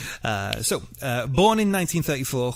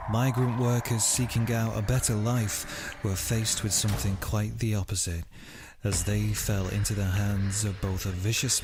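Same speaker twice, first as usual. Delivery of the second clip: hushed documentary voice opening a murder story; dramatic podcast intro; 4 semitones lower than usual. Acted. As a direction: read it like a true-crime trailer. Dramatic true-crime intro read.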